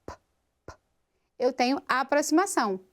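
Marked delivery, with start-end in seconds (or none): 0.0-0.7 "p" as a bare voiceless puff, no vowel; just the lips popping "p" without voice; unvoiced p. Shown as a demonstration. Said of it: "de ser uma consoante sem vozeamento". ("p" surdo, só o estouro dos lábios)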